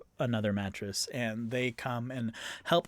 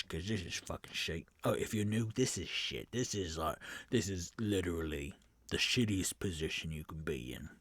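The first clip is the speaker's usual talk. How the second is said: low, softer muttered complaint; bandit griping quietly; 6 semitones lower, 6 dB quieter than usual. Acted. Bandit quietly complains to recruits about his rotten post. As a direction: confide the misery under your breath.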